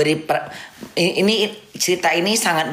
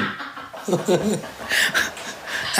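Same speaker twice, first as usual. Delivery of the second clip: breathy laugh; 7 dB quieter than usual. Real, not acted. Laughing at own joke.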